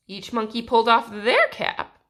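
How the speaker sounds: ordinary delivery, nothing marked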